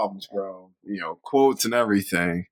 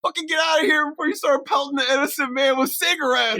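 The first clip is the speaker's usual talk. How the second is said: mock yell. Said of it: "He's like".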